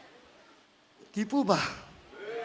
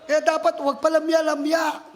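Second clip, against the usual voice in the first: usual speaking voice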